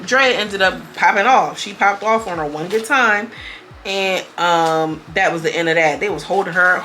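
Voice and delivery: ordinary delivery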